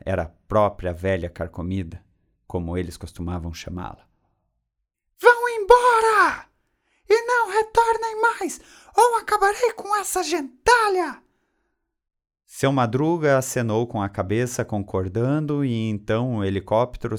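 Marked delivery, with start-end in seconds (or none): 5.2-11.2 loud, very high strident shriek; irritating high-pitched angry scream; Dona Florinda screaming. A narrator's reading. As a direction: shriek it like an alarm. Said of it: "Dona Florinda deu seu último grito".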